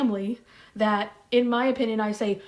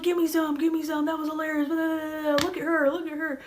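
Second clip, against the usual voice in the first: higher, hammed-up proud stepdad praising; imitating stepdad; 6 semitones higher than usual. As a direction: play the stepdad cheering her on, pitched up. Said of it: "he'd be like".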